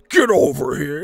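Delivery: loud game-character shout; Scorpion yell. Retold or imitated. Imitating Scorpion again, a loud shout. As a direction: bellow it like Scorpion. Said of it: "did she just Scorpion me?".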